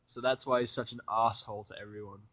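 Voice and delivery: ordinary delivery, nothing marked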